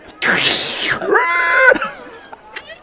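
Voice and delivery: put-on high wailing cry of a frightened girl; child shrieking and crying. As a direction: let out a shrill scream. Girl cries after threat.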